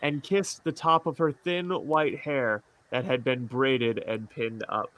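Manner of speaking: soft, slow story reading; story narration. Acted. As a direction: read it tenderly, softly. Reads fantasy story aloud.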